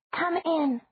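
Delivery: put-on high, small child voice; a four-year-old repeating as told; obedient little girl. Little Margaret obediently repeats the words, high child voice. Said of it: "Margaret said obediently".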